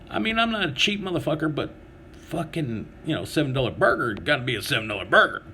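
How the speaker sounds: ordinary talk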